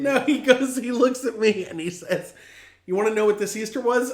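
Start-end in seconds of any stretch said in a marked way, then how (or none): 2.9-4.1 higher, fast, unusual voice; a hint of a strange dad voice; dad talking unlike himself, lightly mimicked; dad's odd voice. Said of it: "he doesn't talk like this".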